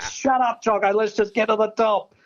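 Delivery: pitched high, telling him to pipe down; nervous and fed up with the chatter; irritated retort. Retold or imitated. Snapping at chatty mate up high.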